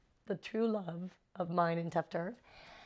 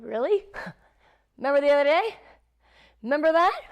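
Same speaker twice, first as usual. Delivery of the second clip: high, loud, pointed questions; vengeful taunt; 10 semitones higher, 10 dB louder, faster than usual. Retold or imitated. Actress paying back co-star who hurt her, loud taunting. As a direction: throw each question at him, rising.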